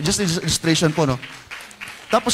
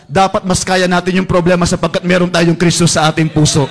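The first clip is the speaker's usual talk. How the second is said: rising, louder preaching to the congregation; 6 dB louder than usual. Acted. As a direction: drive the message home, louder. Preaching builds to full force.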